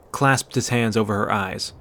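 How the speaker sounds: ordinary delivery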